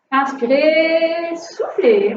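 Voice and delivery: slow, sing-song inhale cue; drawn-out breathing cue. Real, not acted. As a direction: stretch the word like a breath. Instructor guides breathing.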